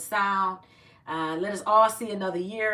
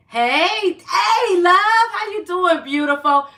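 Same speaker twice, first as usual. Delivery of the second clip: excited greeting; 9 semitones higher, 10 dB louder, much slower than usual. Real, not acted. Greeting a viewer in live chat.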